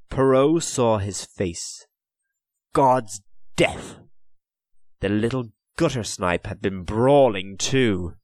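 ordinary delivery

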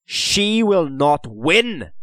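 slow, high, loud defiance; fierce. A narrator's reading. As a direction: defy it fiercely, word by word. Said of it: "he said fiercely".

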